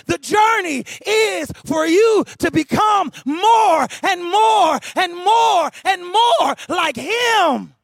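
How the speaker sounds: high sermon shout, building to drawn-out words; full-force preaching. Real, not acted. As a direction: build to a ringing climax, stretching each phrase. Preaches to a climax.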